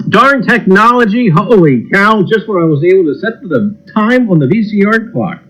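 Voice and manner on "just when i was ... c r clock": ordinary talk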